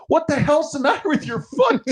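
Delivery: high-pitched, startled dad's outburst; alarmed retelling. Retold or imitated. Dad spots daughter's foot on the boat, alarmed.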